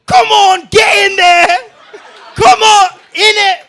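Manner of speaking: loud high bossy shout; the Queen shouting to her subjects, hammed-up loud; mock Queen yelling. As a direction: bark orders like a pushy old queen. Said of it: "the Queen's like".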